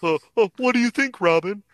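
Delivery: high, theatrical falsetto character; Brook. Acted. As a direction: play it in a high, hooting cartoon voice. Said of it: "I can't not do Ian".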